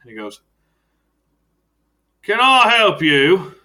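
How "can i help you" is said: high, loud, slow guard asking who's there; a suspicious doorman drawing it out. Acted. Guard at the door asks the visitors, high and loud.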